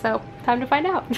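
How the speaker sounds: normal talking voice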